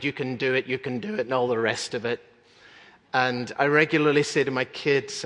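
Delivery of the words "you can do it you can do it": ordinary talk, nothing marked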